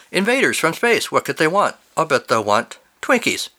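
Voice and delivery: high, theatrical opening easing to normal; animated story narration. Acted. Host reading a listener's silly story, animated narration. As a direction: read it like a breathless pulp tale.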